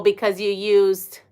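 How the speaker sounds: usual speaking voice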